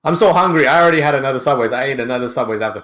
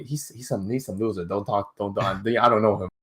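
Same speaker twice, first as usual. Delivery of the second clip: softer, dismissive mumble; quiet brush-off; 4 semitones lower, 8 dB quieter than usual. Retold or imitated. Scooter brushes off the Subway clerk, quiet and dismissive. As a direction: wave her off under his breath. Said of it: "He's like".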